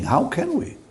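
high, pleading rhetorical question; how can we, rising high; emphatic question. Real, not acted. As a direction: throw the question up to the congregation. Preacher repeats rhetorical question.

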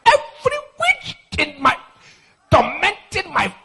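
high, halting prayer cry; demonstrated warfare prayer. Shown as a demonstration. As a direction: act out praying against a witch. Demonstrates shouted prayer against witches.

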